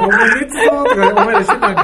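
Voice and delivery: high, loud, laughing retort; laughing mock accusation. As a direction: blurt it out through laughter. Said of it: "って話になるんで".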